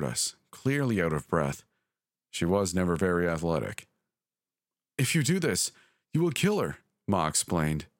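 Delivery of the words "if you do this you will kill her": much higher, pressing plea; breathless mother warns her child, pitched high; urgent warning. A narrator's reading. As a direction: warn them in a rush of fear. Panicked mother stops ritual.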